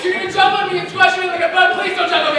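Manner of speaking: high, loud, terrified cry; panicked shriek; hysterical. Acted. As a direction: cry out as if about to be crushed. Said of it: "I'm hysterical".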